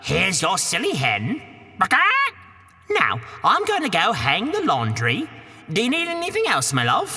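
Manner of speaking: put-on high Cockney woman shooing the boy; a high, brash Cockney housewife; Cockney ogre's wife. Wife shoos Jack from the castle door, high Cockney.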